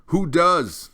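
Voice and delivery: loud high scream; boy screaming at the old turtle. A narrator's reading. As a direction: scream it with all your might. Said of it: "Atreyu screamed even louder".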